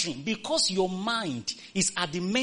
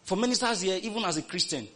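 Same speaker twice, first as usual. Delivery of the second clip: ordinary delivery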